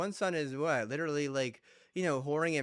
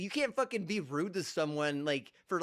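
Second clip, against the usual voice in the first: usual speaking voice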